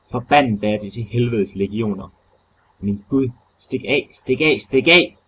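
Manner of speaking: builds from a shout to a high loud scream; shout swelling to scream. Acted. Warren's last warning to Carter, swelling into a scream. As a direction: let it swell until it breaks into a scream. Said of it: "svulmede nu op til et råb. Et råb, der gradvist steg til et skrig".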